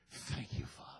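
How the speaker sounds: breathy whisper of thanks; whispered prayer. Retold or imitated. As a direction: whisper it to God under your breath.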